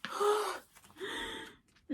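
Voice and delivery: high, very slow drawn-out wonder; breathless, high-pitched awe; awed gasp. Real, not acted. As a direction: stretch it out, stunned with joy. Seeing prized edition.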